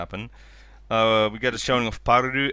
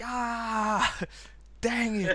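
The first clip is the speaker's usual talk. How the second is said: much higher, softer imitated groan of defeat; mock frustration; 11 semitones higher, 4 dB quieter, much slower than usual. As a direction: laugh it off in a high put-on voice. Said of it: "They were like".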